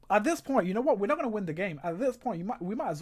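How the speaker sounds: loud, frustrated rant; exasperated outburst. Real, not acted. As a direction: vent at the team, loudly. Frustrated fan watching a loss.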